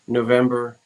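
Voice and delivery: usual speaking voice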